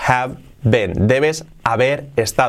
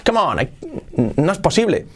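higher, protesting and incredulous; acted disbelief; 6 semitones higher than usual. Teacher plays another context, incredulous protest. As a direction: act indignant, swinging the pitch up. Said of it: "Podéis darle distintas entonaciones".

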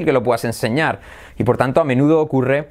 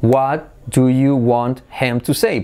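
slow "What... do... you... want... him... to... say"; deliberate, unlinked word-by-word question; separate words; much slower than usual. Shown as a demonstration. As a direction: sound out every word from "What" to "say" in full. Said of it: "Por separado".

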